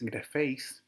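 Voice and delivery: grafeis stressed on the last syllable, graFEIS; stress on second syllable. Shown as a demonstration. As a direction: put the accent on -feis in grafeis. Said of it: "con el acento en, en la segunda sílaba".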